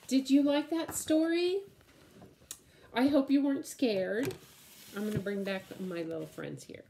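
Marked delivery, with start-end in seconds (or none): 0.1-4.3 excited. Acted. Excited question after story.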